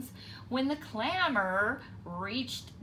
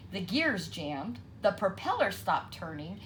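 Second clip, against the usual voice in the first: ordinary delivery, nothing marked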